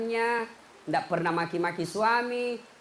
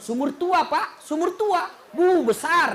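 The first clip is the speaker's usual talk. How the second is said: higher, louder, building the tale; excited telling; 7 semitones higher, 5 dB louder, slower than usual. Real, not acted. Preacher describing the old well, higher and louder.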